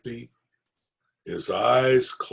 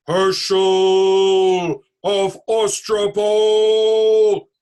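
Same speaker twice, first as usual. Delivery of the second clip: very slow, much louder monster roar; fearsome booming voice; 7 semitones higher, 10 dB louder, much slower than usual. Acted. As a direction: boom his name like a thunderclap. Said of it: "a fearsome voice spoke".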